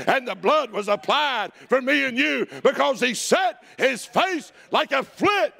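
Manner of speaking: high, driving pulpit cadence; full-force preaching. Real, not acted. Pastor preaching Calvary and resurrection to his church, full-force preaching. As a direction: preach it hard, pitched high and rolling.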